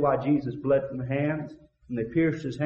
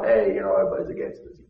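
crooks conspiring; 6 semitones lower, faster than usual. Acted. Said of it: "jailhouse birds gang".

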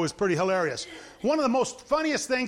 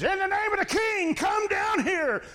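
much higher, shouted official command, slowing down; pompous summons; 11 semitones higher than usual. Retold or imitated. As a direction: bellow a royal order up the hill. Captain summons Elijah.

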